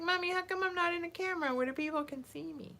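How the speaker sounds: put-on high, fast little-kid voice asking mommy; squeaky toddler complaint. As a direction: voice the baby whining to be seen. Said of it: "You're like".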